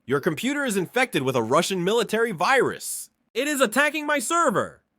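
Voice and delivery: mock terrified shouting, pitch well up; terrified yell. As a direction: yell in genuine terror. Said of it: "He yells".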